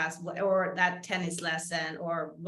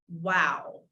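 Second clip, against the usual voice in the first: ordinary talk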